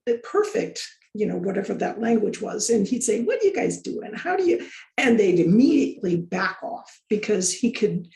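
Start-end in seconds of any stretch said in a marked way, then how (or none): 3.2-4.7 higher-pitched, lightly acted quote; imitating a breezy hello; quoted casual greeting. Quoting his friendly greeting in retelling.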